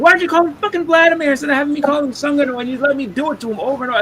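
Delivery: fast, high-pitched tirade; indignant rebuke. Retold or imitated. Speaker berating friend over the nickname, fast high indignation. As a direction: rattle off an exasperated retold complaint.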